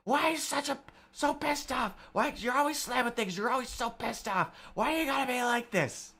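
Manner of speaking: high, strained screamed complaint; mock screaming. Boyfriend screaming at girlfriend after a slammed door, mock high scream. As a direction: imitate an exasperated boyfriend screaming in a high-pitched voice. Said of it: "and he's screaming".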